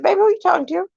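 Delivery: retold mom to her small son, sweetly. Mother asking young son.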